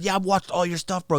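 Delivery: high-pitched imitated admirer; mock starstruck bro; gushing fan. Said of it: "He said".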